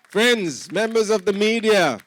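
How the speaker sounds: loud, high call to the room. Real, not acted. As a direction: address the whole hall loudly. Host calling out to audience.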